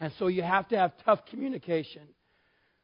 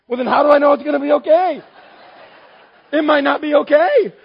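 high, loud comic outburst; hammed-up bellow of disbelief; exasperated yelling; 9 semitones higher, 12 dB louder than usual. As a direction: shout it in utter frustration. Acted quarrel, husband loses his cool.

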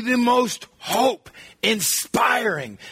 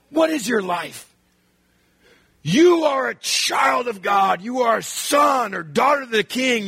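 bust out; 3 semitones higher than usual. Shown as a demonstration. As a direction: proclaim it like a rally cry. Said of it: "I was expecting James to bust out".

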